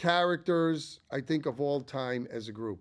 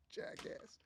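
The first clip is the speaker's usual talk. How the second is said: whispered aside; 19 dB quieter than usual. Real, not acted. Muttered aside.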